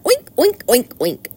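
high, squealed oinking; pig oinks. A narrator's reading. Boy pretends to be a pig, high oinks. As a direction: oink like a boy playing piglet.

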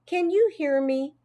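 slightly high-pitched hamster voice; squeaked question. A narrator's reading. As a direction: pipe up hopefully as a small pet. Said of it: "I squeaked".

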